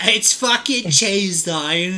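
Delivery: lightly hammed-up regional English accent; mock English accent. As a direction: put on a joke English accent. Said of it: "which part of England?".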